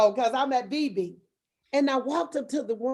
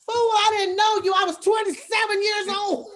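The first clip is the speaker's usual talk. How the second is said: loud, high shout of mock outrage; retold shout; 8 semitones higher, 5 dB louder than usual. Retelling at church what she wanted to yell: loud, high mock shout. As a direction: yell it like you finally got to tell her off. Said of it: "I wanna say".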